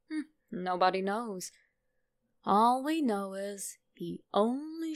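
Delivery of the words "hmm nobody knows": ordinary delivery, nothing marked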